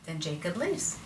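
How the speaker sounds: usual speaking voice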